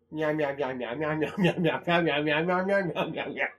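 a string of lightly voiced cat meows; playful repeated meows; mock meowing. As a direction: meow it like a cartoon cat. Imitating a cat.

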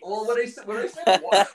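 high, laughing through the words. Real, not acted. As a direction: giggle while you explain. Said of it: "laughing while explaining".

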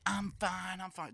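put-on drunk girl voice. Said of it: "she's getting stupid".

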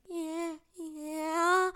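high mock-tearful wail; depressed kid voice. Third grader confirming the bullying, high tearful kid voice. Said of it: "I was getting really depressed".

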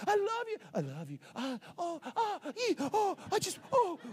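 high swooning coos; mock lovesick. Dating couple cooing on the phone, high swooning.

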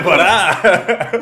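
high, loud, laughing "pará"; laughing stop. Retold or imitated. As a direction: cut him off mid-laugh. Friend stops her, laughing.

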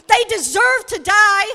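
loud angry preaching shout. Acted. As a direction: hurl it out like a judgement. Preacher voicing God's anger.

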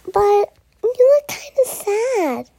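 high, concerned unicorn voice; cheerful little unicorn. Acted. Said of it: "a little unicorn".

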